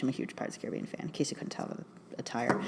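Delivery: quick whispered joke; whispered aside. Real, not acted. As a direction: whisper it behind your hand. Joking aside about own outfit.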